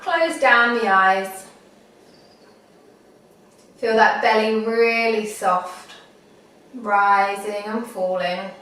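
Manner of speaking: unhurried meditation guide, long pauses; guided meditation. Acted. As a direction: talk them gently through it, phrase by phrase.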